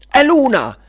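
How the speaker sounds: very high, loud witch's cry; exclaiming. Acted. Witch of discord spots Luna, exclaiming loudly. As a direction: shriek it out on spotting her. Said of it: "esclamò la strega della discordia".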